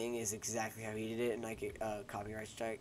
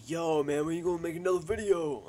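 impatient fan; 6 semitones higher, 7 dB louder than usual. Retold or imitated. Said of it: "be like".

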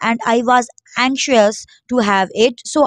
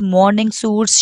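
plain reading voice

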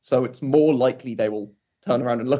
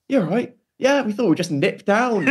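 higher-pitched imitation of breezy arrivals; casual arriving Scots; 10 semitones higher, 3 dB louder than usual. Friends turning up after overnight travel, high mock-casual greeting. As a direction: play it casual, pitched well up. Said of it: "just show up and they're just like".

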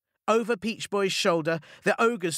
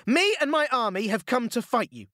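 put-on higher, proud young hero's challenge; bold declaration; 8 semitones higher than usual. A narrator's reading. Momotaro challenging the ogres, proud declaration. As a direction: announce it like a brave boy hero. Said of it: "he declared".

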